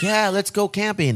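imitating thrilled children, high and drawn-out; ecstatic kids. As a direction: do excited kids, high and stretched. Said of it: "are fucking ecstatic".

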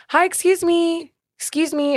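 retold call to catch their attention; calling after someone. Calling after customers walking away.

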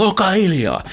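kid's pretend battle cry from the plane; retold yell. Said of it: "ja huudetaan". (korkealle nostettu leikkimielinen huuto)

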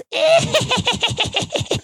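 high shrieking cackle; Crypt Keeper cackle. Acted. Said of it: "best Tales from the Crypt laugh".

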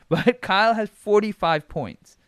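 laughing. Real, not acted. Repeats Kyle's score, laughing.

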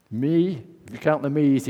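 ordinary delivery

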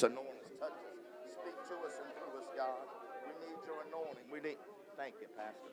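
near-whispered prayer, fast and soft; hushed prayer. Real, not acted. Preacher quietly praying over the congregation, hushed.

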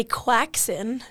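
"quaxin" as KWAK-sin for croissant; mangled croissant, "quaxin"; Tom Holland. Retold or imitated. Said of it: "be like Tom Holland and say".

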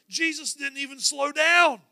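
ordinary talk, nothing marked

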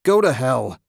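loud shouted defiance; wounded husband roaring at the clawing creatures; defiant bellow. A narrator's reading. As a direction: spit it at the monsters. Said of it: "he bellowed".

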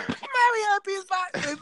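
put-on excited child telling mom about the party; kid voice. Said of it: "this is how kids talk".

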